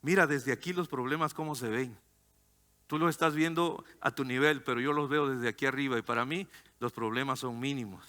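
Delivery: low, soft reassurance; God as a calm dad, voiced low and gentle; calming father. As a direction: soothe a worried child quietly. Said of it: "empieza a decirnos, "Calmado, mijo".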